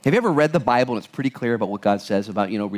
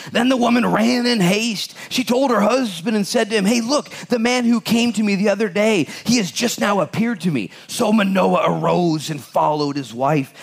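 raised, emphatic Bible reading voice; scripture reading; 10 semitones higher, 3 dB louder than usual. Acted. Pastor reading Judges to the church, emphatic reading voice. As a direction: proclaim the verses to the congregation.

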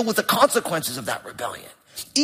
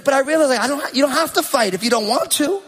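ordinary talk

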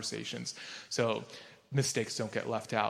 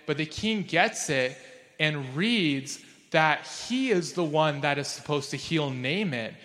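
ordinary talk, nothing marked

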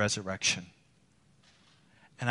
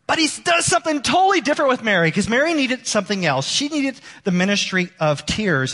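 much higher, louder, fast burst; excited preaching; 10 semitones higher, 5 dB louder than usual. Acted. Pastor turning to Mary's story, fast and loud. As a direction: launch into the contrast with energy. Sermon turn to Mary.

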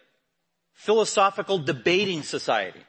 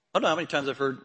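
ordinary delivery

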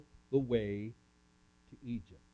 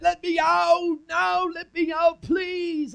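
high, loud pleading shout; desperate yell; 8 dB louder, slower than usual. Acted. Joseph begging his brothers from the well, high loud yell. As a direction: scream up from the bottom of the well. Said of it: "I yelled".

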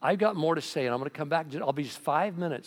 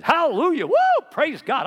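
hallelujah shouted high and loud; ecstatic shout; 11 dB louder than usual. Retold or imitated. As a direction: burst out in joyful praise. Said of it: "this guy goes".

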